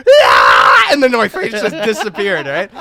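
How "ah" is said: loud falling scream. Retold or imitated. Telling friends how he screamed falling, loud yell. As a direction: yell as if dropping into nothing. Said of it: "I just went".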